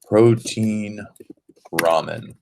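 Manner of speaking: ordinary delivery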